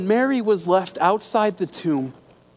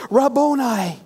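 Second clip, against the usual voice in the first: a raised, joyful cry of recognition; cried out loud; 5 semitones higher than usual. A narrator's reading. Mary recognising Jesus, cries out the name loudly. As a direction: call his name out in sudden joy. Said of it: "cried out in a loud, out loud in Aramaic".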